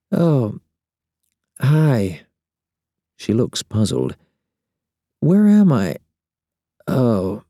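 put-on a just-woken young woman; sleepy woman voice. Ramona waking, voiced.

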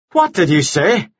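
high-pitched angry demand; furious. A narrator's reading. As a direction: round on her with an outraged question. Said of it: "suddenly furious. He rounded on her".

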